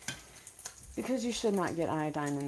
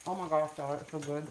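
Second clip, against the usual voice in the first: ordinary talk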